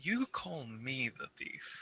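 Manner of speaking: slow, hushed in-character whisper; low-volume, drawn-out whispered accusation; whispering comment. Acted. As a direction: whisper it slowly, a pointed aside. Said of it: "like, a whispering comment to Armand".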